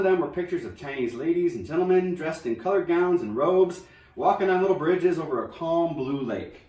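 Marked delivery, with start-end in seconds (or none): none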